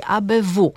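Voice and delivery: "A B W" as a-be-WU; stress last syllable. Shown as a demonstration. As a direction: spell out "A B W", leaning on the final letter. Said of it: "akcentujemy zawsze na ostatniej sylabie". („A B W” z akcentem na WU)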